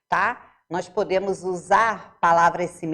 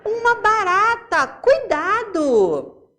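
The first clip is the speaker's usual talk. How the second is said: much higher, louder shriek of alarm, dragging out cuidado; alarmed exclamation; 11 semitones higher, 5 dB louder, slower than usual. Shown as a demonstration. Teacher acting out an exclamation for the class, alarmed shout. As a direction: cry out as if a cockroach ran past. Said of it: "colocar a, a pontuação correta".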